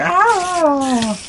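high-pitched mock shriek; imitated scream. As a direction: let out a sudden high squeal. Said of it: "You're like".

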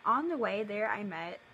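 ordinary talk, nothing marked

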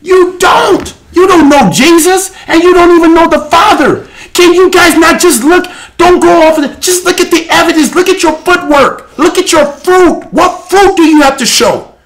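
high, impassioned accusation from the pulpit; fiery preaching. Real, not acted. Preaches at full force.

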